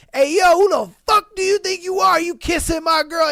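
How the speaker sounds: loud, high, furious confrontation; retold angry shout. Jealous boyfriend yells at classmate in mock fury. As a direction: storm in yelling at the rival.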